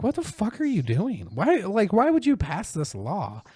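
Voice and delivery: high, incredulous what-are-you-doing; exasperated rant. Retold or imitated. Ranting at the lawmakers, high incredulous disbelief. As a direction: throw up your hands at lawmakers. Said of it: "I was like".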